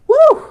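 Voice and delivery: high loud whoop of excitement; excited woo. Real, not acted. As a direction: let out a big excited whoop. Hyped cheer on livestream.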